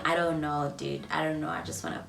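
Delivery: soft, tired, fading; defeated at the gate after the date; weary defeated. Retold or imitated. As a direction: say it exhausted. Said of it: "I remember saying".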